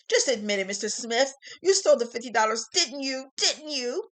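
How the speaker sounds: loud, pressing accusation; accusing shout. Acted. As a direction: corner him and demand a confession. Prosecutor pressures witness.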